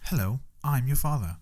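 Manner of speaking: put-on British-accented robot dad, softly spoken; prim C-3PO-style British accent; C-3PO-like Elon Musk. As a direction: do a bad Musk that comes out British. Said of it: "That was definitely C three P O".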